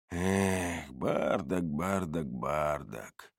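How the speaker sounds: low, soft chiding; mock reproach. A narrator's reading. Narrator wags a finger at Бардак, quiet mock reproach. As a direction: scold him quietly in a low voice. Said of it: "погрозил я ему пальцем".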